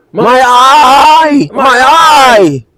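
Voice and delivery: mocking whine over an injured eye; mock crying fighter. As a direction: ham up the pain. Mocking Aspinall's complaints.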